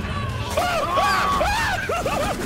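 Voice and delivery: very high-pitched repeated shrieks; real jump-scare screaming; panicked screams. Real, not acted. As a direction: scream in fright, over and over. Attacked in game.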